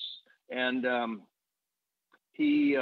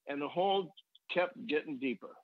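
usual speaking voice

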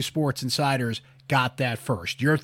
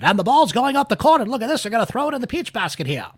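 high, loud, fast vintage play-by-play; old-timey radio voice; 9 semitones higher, 5 dB louder, faster than usual. Shown as a demonstration. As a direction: call it like a 1920s radio announcer. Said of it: "break out my old-timey radio voice".

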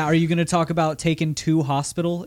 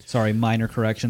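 ordinary talk

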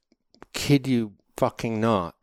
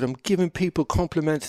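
usual speaking voice

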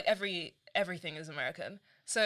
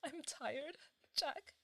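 mock weak; 8 semitones higher, 10 dB quieter, much slower than usual. Dying Emma line.

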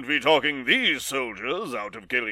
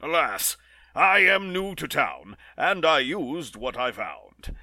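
normal talking voice

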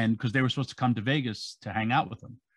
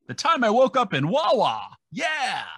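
excited tease; 12 semitones higher, 5 dB louder than usual. Real, not acted. Teasing next week's story.

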